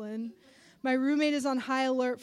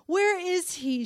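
tearful; 7 semitones higher, 6 dB louder, slower than usual. Acted. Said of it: "She starts to cry".

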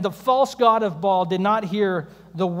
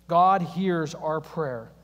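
slow emphasis; 3 semitones lower, much slower than usual. Acted.

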